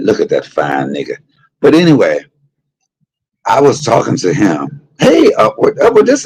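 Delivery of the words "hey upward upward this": high greeting. Real, not acted. Calling out to viewer.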